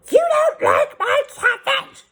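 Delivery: high, slow outraged screech; an offended aunt screeching. A narrator's reading. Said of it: "she would screech".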